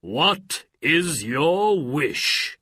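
booming, slow genie voice. Acted. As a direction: speak as a grand genie. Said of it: "said the genie of the lamp".

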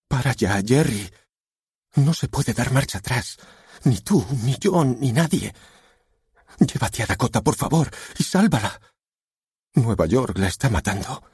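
high, urgent plea; pleading wife. A narrator's reading. Wife begs husband to save daughter.

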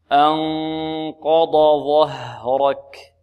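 أنقض ظهرك drawn out slowly, heavy emphatic ض then ظ; slow careful recitation. Shown as a demonstration. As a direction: stretch every letter of أنقض ظهرك. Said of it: "لا نقول ظه".

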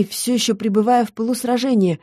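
ordinary delivery

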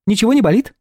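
high, sweet chirpy nurse voice; chirping. A narrator's reading. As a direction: chirp it like a cartoon princess. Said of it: "прощебетала девушка".

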